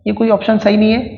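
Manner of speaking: usual speaking voice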